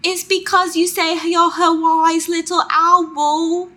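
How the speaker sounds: put-on slow, high, loud little British child; British baby voice. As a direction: pipe up as a British tot. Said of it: "That's my British baby voice".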